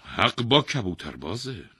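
loud, slow royal verdict; pronouncing king. A narrator's reading. King passing judgement on the thief, imperious. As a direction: rule on the case loudly, weighing each phrase.

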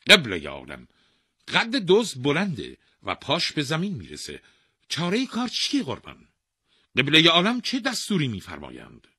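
high, loud, deferential officer voice; pleading royal officers. A narrator's reading. Officers asking the king for orders, anxious and deferential.

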